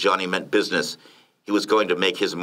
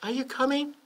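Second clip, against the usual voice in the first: soft, high falsetto; wife's voice; 6 dB quieter than usual. A narrator's reading. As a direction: ask your husband softly in falsetto. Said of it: "She brushed the cigar smoke away from her nose and said".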